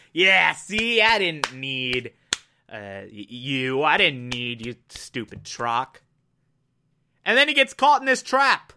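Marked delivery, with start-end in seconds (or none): 0.1-6.0 a touch of a low, slow, smug put-down; smug dismissive Valior. Retold or imitated. Valior lightly voiced, brushing off the alien who helped. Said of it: "is like".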